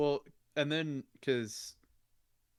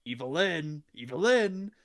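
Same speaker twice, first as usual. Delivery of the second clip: calling out; 5 semitones higher, 5 dB louder than usual. Retold or imitated. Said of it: "he turns around and he goes".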